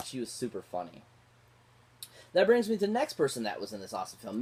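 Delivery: ordinary talk, nothing marked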